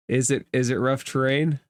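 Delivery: usual speaking voice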